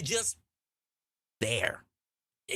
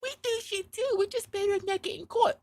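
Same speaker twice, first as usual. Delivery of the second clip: women's voice. Retold or imitated. Women admitting they cheat too, high voice. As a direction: confess it lightly in a high girlish voice.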